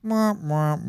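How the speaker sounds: ordinary talk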